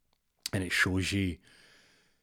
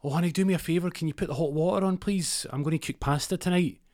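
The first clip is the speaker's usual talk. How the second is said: high, loud call from another room, a wife asking a favour; wife calling out to McNulty, high and loud; 9 semitones higher, 4 dB louder, faster than usual. Retold or imitated. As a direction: shout through the house to your husband. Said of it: "Amira shouts".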